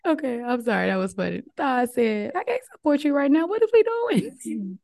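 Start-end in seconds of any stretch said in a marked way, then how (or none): 2.3-4.8 high-pitched protesting body part; complaining body voice. Acted. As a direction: wail it like an overworked body giving up, hammed-up. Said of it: "That's how my body talks to me".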